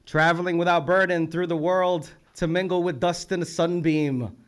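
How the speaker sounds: ringing poetry-reading voice; poem recital. Real, not acted. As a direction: declaim the line to the whole room. Reads back a poet's lines.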